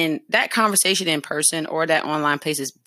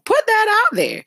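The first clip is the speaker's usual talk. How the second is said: "Put" hit high and loud, falling away; emphatic urging; 5 dB louder than usual. Real, not acted. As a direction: punch "Put" high then drop. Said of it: "urging listeners".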